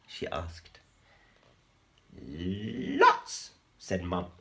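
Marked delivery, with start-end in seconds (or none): 2.2-3.5 lightly high mum spider. A narrator's reading. Mum voiced.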